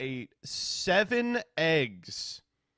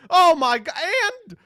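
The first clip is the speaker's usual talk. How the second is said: very high, loud disbelief; loud, comic dismay; exasperated shout; 12 dB louder, slower than usual. Real, not acted. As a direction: burst out in outraged disbelief. Spots yet another rival live.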